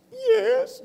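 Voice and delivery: very high, crying "Yes" in imitation; sobbed yes. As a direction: squeak out a tearful "Yes" like his wife did. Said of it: "wiping the tears away".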